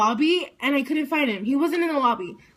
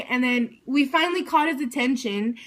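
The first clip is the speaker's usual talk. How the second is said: ordinary talk, nothing marked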